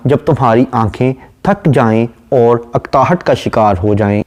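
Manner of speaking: ordinary talk, nothing marked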